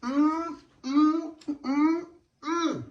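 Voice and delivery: high, savoring hums while eating; yummy mm. Real, not acted. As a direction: hum with delight at each bite. Tasting the tostada.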